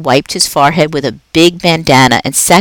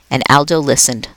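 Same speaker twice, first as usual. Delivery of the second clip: ordinary delivery, nothing marked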